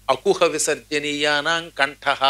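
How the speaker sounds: slow, chanted grammar rule; sutra recitation. Shown as a demonstration. Teacher reciting a Sanskrit grammar rule, chanted slowly. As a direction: intone the Panini sutra slowly. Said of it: "అని వ్యాకరణంలో సూత్రం".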